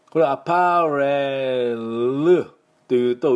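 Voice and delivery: アパレル slowed, with the final ル drawn out; アパレル, the ル fully voiced as a mistake; drawn-out wrong ending. Shown as a demonstration. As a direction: stretch out アパレル to show the added u sound. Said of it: "うが入ってしまいますので".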